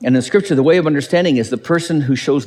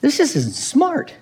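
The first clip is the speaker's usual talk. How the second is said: honest admission; 9 semitones higher, slower than usual. Retold or imitated. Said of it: "forthright enough to say".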